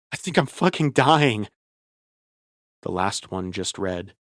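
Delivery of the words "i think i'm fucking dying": panicked. Acted. Panicked text read aloud.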